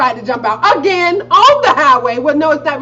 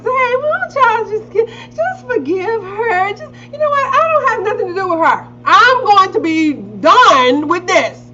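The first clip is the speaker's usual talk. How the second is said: a hint of pleading, higher; lightly voiced sisters begging; 8 semitones higher than usual. Sisters pleading with her to forgive mom. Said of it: "all my sisters".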